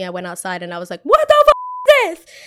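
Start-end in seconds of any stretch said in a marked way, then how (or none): 1.0-2.3 high, slow, loud shocked shriek; shocked scream. Retold or imitated. Catching her friend with Johan, a shocked scream. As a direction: scream it out in disbelief. Walking out to find them kissing.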